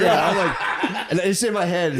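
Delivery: laughing. Real, not acted. Laughing explanation.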